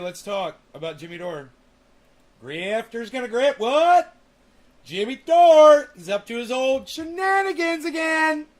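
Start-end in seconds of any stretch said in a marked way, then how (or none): 2.4-8.5 high, loud, ranting YouTuber voice; mock a shouting commentator; Cult of Dusty impression. Mocking a rival streamer in a loud ranting voice. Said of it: "my Cult of Dusty impersonation".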